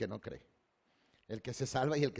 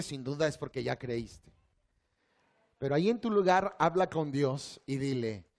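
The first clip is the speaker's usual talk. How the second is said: ordinary delivery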